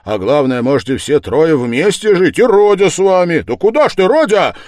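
loud, high, eager rush of plans; exuberant, full-voiced urging; excited shouting. A narrator's reading. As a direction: pitch it loud to sweep everyone along. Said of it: "закричал Разумихин".

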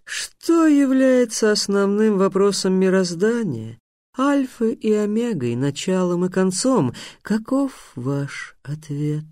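high, slow, grandly intoned riddle; drawn-out, high creature voice, easing down at the end; cheerful sphinx. Acted. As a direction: stretch each phrase out like a riddling monster.

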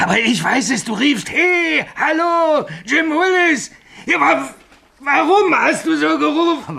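half-screaming in panic; hysterical. Acted. Signalman frantically confronts Bill, high panicked voice.

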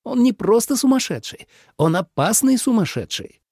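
высокий, возмущённый голос персонажа; возмущайся на высоких нотах; возмущённый император. A narrator's reading. (возмущённо, высоким голосом)

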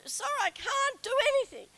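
high, loud mock whine; whinging. Wife whining to her husband about her sore shoulder, high and loud. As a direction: complain about the shoulder like a sulky child. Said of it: "I was whinging".

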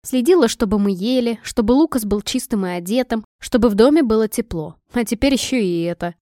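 ordinary delivery

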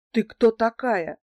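a dazed thug demanding to know who she is; surprised question. A narrator's reading. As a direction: blurt it out, caught off guard. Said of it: "спросил меня удивлённо Золотов".

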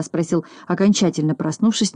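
usual speaking voice

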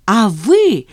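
loud, pointed opener; inspired attack. A narrator's reading. Zhanna turning on the chief accountant, with inspired zest. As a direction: launch in with fresh zest. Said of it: "вдохновенно продолжила Жанна".

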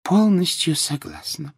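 high, slow female character voice; agreeing woman professor. A narrator's reading. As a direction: chime in high and drawn out. Sprout chimes in agreement.

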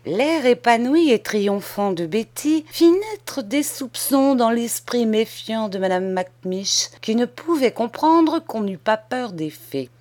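plain reading voice

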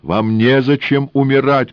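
high, loud urgent cry; shout. A narrator's reading. Engineer shouts to the woman with the gun. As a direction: shout to stop her dying. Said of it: "крикнул он".

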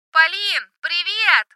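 high, loud yell into the phone; shouting over music. Acted. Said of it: "заорала в трубку Соня, пытаясь перекричать эту самую музыку".

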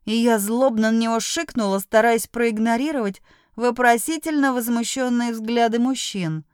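plain reading voice

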